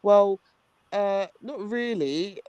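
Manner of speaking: retold hesitant reply. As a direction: hedge slowly, imitating a reluctant friend.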